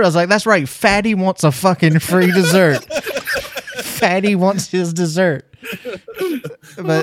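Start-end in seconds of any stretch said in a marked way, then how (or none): 0.3-2.9 loud, high, drawn-out comic demand; mock defiant boast. Mocked diner to onlookers, loud defiant boast. 3.8-5.4 slow, higher comic demand; mock demanding. Mocked diner claiming free dessert, slow mock demand.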